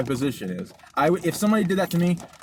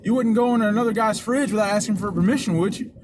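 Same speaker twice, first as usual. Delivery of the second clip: higher-pitched mock southern drawl; put-on Southern accent, scolding; super southern drawl; 6 semitones higher than usual. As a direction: imitate a Southern man's indignant drawl. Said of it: "this super southern drawl".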